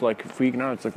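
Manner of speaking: ordinary delivery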